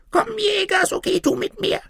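very high, squeaky begging voice; pleading tiny man. A narrator's reading. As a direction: beg like a little gnome. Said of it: "bat das winzige". (bittendes Männlein mit hoher Piepsstimme)

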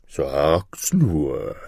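low monster bird voice; deep creature prodding; curious griffin. Acted. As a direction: urge it on from down deep. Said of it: "rief der Vogel Greif".

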